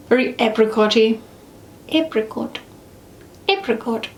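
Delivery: dainty British pronunciation; British accent. Acted. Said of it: "Whenever I do a British accent".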